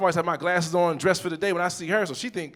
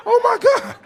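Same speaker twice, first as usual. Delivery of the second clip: very high, loud shriek; over-the-top high-pitched alarm; mock horrified scream; 9 dB louder than usual. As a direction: scream it in hammed-up horror. Acted horror at glasses being bent.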